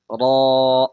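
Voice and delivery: را said thick and loud from the throat; full heavy ra. Shown as a demonstration. Teacher showing the heavy ra to pupils. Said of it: "پر کر کے پڑھیں گے اس کو".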